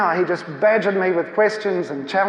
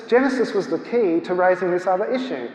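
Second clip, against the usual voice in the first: ordinary talk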